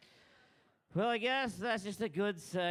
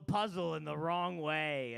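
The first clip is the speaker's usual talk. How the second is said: ordinary talk, nothing marked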